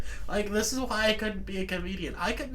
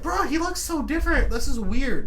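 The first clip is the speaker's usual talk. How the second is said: astonished; 5 semitones higher, 6 dB louder than usual. Real, not acted. Stunned by changed look.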